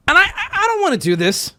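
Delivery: laughing reluctance. Real, not acted. Laughs while hesitating.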